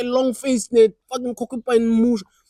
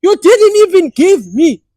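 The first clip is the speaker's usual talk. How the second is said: outraged wail; 9 semitones higher, 11 dB louder than usual. Retold or imitated. Cheated boyfriend protesting to his girlfriend, loud outraged wail.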